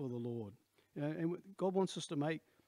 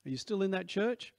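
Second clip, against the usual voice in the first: raised pitch, louder, rising at the end; eager question; 6 semitones higher, 5 dB louder than usual. Retold or imitated. Old acquaintance asking about church, raised and eager. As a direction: ask it as a keen old acquaintance. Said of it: "his first question was".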